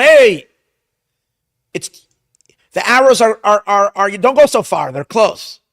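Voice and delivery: high, loud call to the distant lad; yelling out. Retold or imitated. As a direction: shout it across the field. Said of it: "I'm gonna yell out to him".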